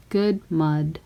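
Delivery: plain reading voice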